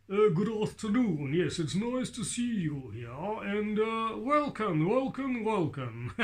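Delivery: high, mature English puppet voice; grown-up English gent puppet, pitched high; Hilton. Shown as a demonstration. Puppet trying out an older character's welcome, high mature English voice. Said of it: "Hilton's voice?".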